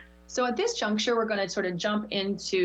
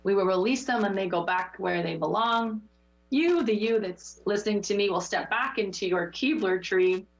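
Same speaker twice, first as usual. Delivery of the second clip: guided meditation. Acted. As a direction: ease them back gently. Leads meditation close.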